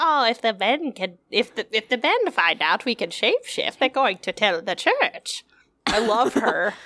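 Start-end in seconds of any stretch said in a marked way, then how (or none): 0.0-5.4 imitating a character. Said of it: "she always like".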